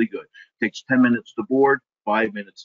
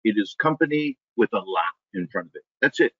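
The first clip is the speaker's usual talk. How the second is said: ordinary talk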